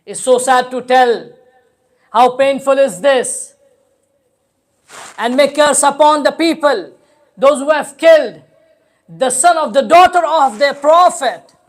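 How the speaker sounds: loud, forceful lament; impassioned preaching. Real, not acted. Preacher curses the killers before mourners, impassioned outcry.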